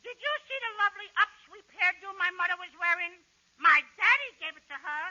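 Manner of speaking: lightly voiced kid; a hint of small-boy chirp; innocent little boy. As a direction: play a sweet child setting up a joke. Costello plays little brother.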